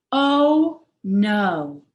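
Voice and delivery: a mother walking into a wrecked kitchen; shocked dismay. A narrator's reading. Mama walks into the messy kitchen, loud shocked dismay.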